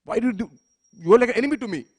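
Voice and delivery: a god snapping at the one who broke his bliss; angry Shiva. A narrator's reading. Shiva angrily scolds Parvati for breaking his trance, rising shout. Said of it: "Lord Shiva was very angry".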